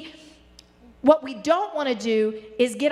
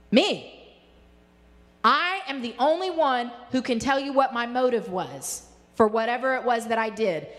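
ordinary talk

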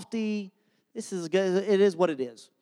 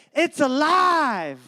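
imitating son's long drawn-out holler; triumphant cry; 7 semitones higher, 3 dB louder, much slower than usual. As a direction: call it out high, mad-scientist style, stretching the last word. Son calls out from bedroom.

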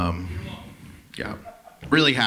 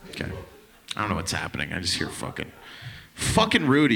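ordinary talk

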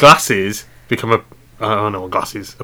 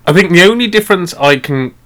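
ordinary talk, nothing marked

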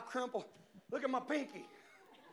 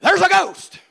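much higher and louder urgent call to a brother; urgent kid's call; 11 semitones higher, 17 dB louder than usual. Retold or imitated. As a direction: wake him up, there's a ghost. Kid waking brother about ghost.

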